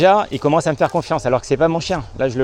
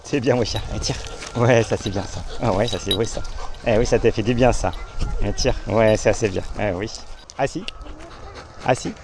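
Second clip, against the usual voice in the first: talking to a dog; 6 semitones lower, 5 dB quieter than usual. Real, not acted. Quiet praise for the dog.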